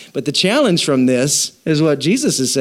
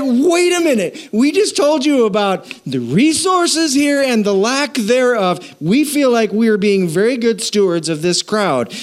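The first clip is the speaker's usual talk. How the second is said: high, pushy protest in a higher voice; indignant disciples; 7 semitones higher than usual. Retold or imitated. Disciples objecting to Jesus about feeding the crowd, high indignant protest. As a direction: pitch it up like a flustered disciple protesting.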